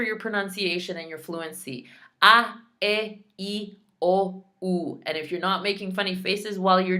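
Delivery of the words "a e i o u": A, E, I, O, U as separate pure ah, eh, ee, oh, oo; A, E, I, O, U chanted like a classroom drill; exaggerated Spanish vowels. Shown as a demonstration. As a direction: recite A, E, I, O, U one by one, wide-mouthed. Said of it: "wide and exaggerated".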